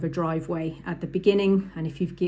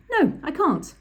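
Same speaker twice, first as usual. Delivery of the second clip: high blurted "No, I can't" from a rattled student; flustered learner; 9 semitones higher than usual. Retold or imitated. Learner blurting a refusal to the examiner, high and flustered. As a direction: play the panicked learner refusing the manoeuvre. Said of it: "And my student went".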